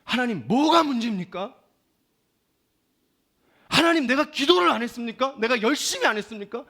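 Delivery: loud, high, impassioned demand to God; acted outburst of pleading frustration; desperate shouted prayer. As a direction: cry out to God, demanding an answer. Reenacts his own desperate, confrontational prayer.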